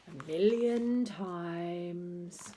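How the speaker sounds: very slow, bored drawl; weary sarcasm. Acted. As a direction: drag it out, fed up with his stories. Bored boys answer teacher.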